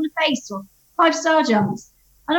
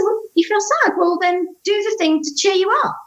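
put-on child's voice; 8 semitones higher than usual. Son voiced, high child voice.

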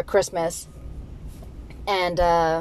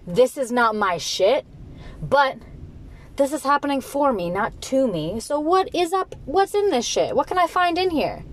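rising, eager questions, higher at the end; a man turning a setback into a search, eager; curious determined man; 5 semitones higher than usual. Retold or imitated. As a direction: dig in with curious enthusiasm. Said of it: "and he said".

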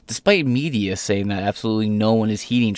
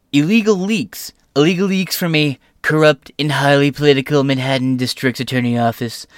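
pitched up, loud and indignant; mock Trump outrage; 5 semitones higher, 4 dB louder than usual. Host reading Trump's post aloud, mock outrage. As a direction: read the post like an outraged rally speech.

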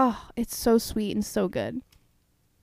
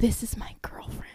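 hushed, unvoiced whisper; whispered confession; 6 dB quieter than usual. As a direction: whisper it like a guilty secret. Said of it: "And he's like".

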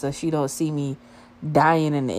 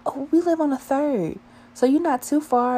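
put-on high girly voice, higher; imitating a girl; 11 semitones higher than usual. One girl telling her friend he lives there, high girly voice. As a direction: pitch up into a chatty girl's voice. Girl quoted at front desk.

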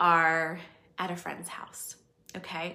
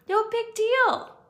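high, loud, slow carefree toss-off; a hint of a cheerful host waving off the spill; breezy no big deal; 13 semitones higher, 8 dB louder, slower than usual. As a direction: shrug it off lightly. Breezy universe shrugging off spill.

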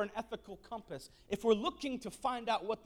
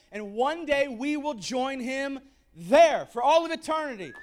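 rising preaching; 8 semitones higher, 5 dB louder than usual. Acted. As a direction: build toward the shout. Preaching building up.

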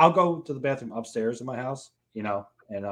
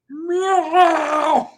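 high, loud drawn-out meow; mimicked cat yawn, pitched way up; cat yawn impression; 7 dB louder than usual. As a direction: yowl like a yawning cat. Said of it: "Your cats yawn and go".

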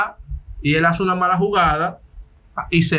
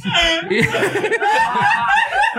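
squealing laugh, much higher than his voice; high-pitched burst of laughter. Real, not acted. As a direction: break into a high helpless laugh. Laughing at his own story.

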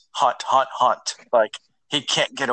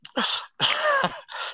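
high-pitched laughter; 4 dB quieter than usual. Real, not acted. Still laughing at username.

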